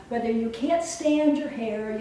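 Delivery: usual speaking voice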